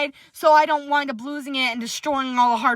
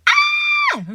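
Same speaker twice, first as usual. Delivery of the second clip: very high, loud shout of joy; excited squeal; 4 dB louder than usual. Real, not acted. To viewers awaiting the Venom sequel, a high delighted shout. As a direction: let the excitement burst out of you. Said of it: "So excited".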